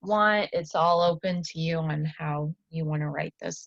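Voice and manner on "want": ordinary talk, nothing marked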